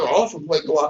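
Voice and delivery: ordinary talk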